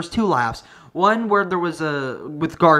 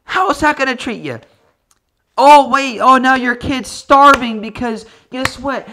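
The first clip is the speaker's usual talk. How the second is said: much higher, louder ranting at her; heated rant, peaking on oh wait; mock angry rant; 9 semitones higher, 7 dB louder than usual. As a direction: yell it like a furious lecture. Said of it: "I'm like".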